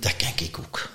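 voiceless whisper to himself; whispered thought. Retold or imitated. Watching the speaker on stage, a private whisper. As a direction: whisper it with quiet confidence. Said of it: "ik denk".

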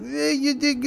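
put-on high, slow Lithuanian old-lady accent, mouth barely open; Lithuanian grandma accent. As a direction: talk through a tight little mouth like a Lithuanian grandma. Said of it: "spoke with a heavy accent".

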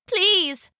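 high-pitched, imploring villager; pleading woman. A narrator's reading. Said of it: "said the woman".

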